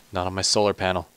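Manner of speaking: ordinary talk, nothing marked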